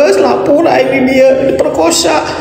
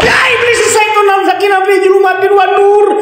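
shouted exorcism; 11 semitones higher than usual. Real, not acted. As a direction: yell the prayer to drive the spirit off. Shouting prayers against a ghost.

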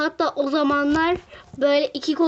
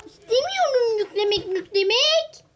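much higher whining refusal; pitched-up petulant complaint; whiny protest; 9 semitones higher than usual. Real, not acted. Whining at forced download.

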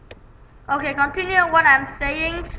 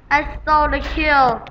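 ordinary talk, nothing marked

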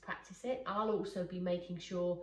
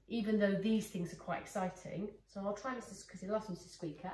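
ordinary talk, nothing marked

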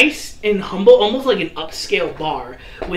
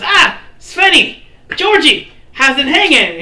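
Fluffy greeting bouncers; 9 semitones higher, 6 dB louder than usual. Acted. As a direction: hail old pals at the door. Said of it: "Fluffy goes".

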